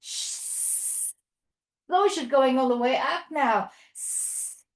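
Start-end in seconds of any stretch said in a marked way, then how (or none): 0.0-1.1 long voiceless sss; snake hiss. Pretend. Teacher being the snake that is back, long soft hiss. 3.9-4.6 long voiceless sss; snake hiss. Pretend. Teacher being the snake that is back, long soft hiss.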